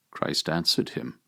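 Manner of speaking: normal talking voice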